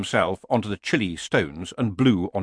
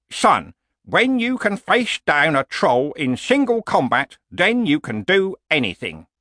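put-on higher, forceful old warrior; old barbarian reminiscing; 7 semitones higher, 3 dB louder than usual. Old barbarian recites his father's wisdom, declaiming.

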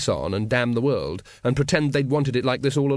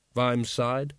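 ordinary delivery

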